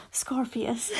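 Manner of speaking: ordinary delivery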